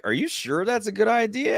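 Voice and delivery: high-pitched, questioning imitation of a wife; doubtful wife. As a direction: imitate a skeptical spouse, pitched well up. Voices his doubtful wife.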